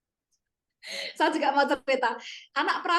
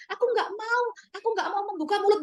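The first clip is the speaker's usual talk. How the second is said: put-on tearful child refusing; 6 semitones higher, faster than usual. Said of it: "bilang begitu".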